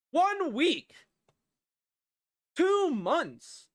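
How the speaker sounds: high-pitched, incredulous boss tirade; exasperated outburst. Acted. Boss exploding over career record.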